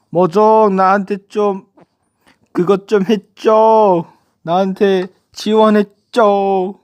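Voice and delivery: high, loud, drawn-out appeal; playful pleading. Pretend. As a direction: plead for sponsorship, stretching the endings. 머정에게 지원을 요청. (높고 길게 늘이며 장난스럽게 조르기)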